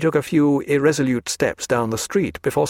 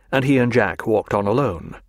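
ordinary delivery, nothing marked

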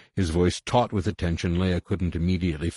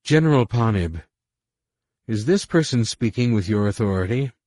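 firm demand; 5 semitones higher, 5 dB louder than usual. A narrator's reading. Leia demands an answer.

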